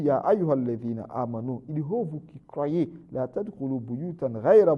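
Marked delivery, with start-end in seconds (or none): none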